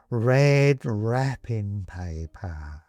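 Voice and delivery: very slow, drawn-out skeleton voice; savouring skeleton. Acted. Skeleton savouring Benedict's memory, very slow drawl. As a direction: relish each word like a treat.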